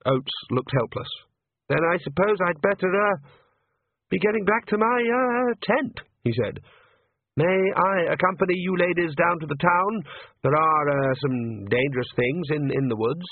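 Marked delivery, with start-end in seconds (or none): 1.7-13.2 higher, hesitant priest voice; nervous young priest. Acted. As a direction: stumble politely, a bit anxious. Priest offers to escort them.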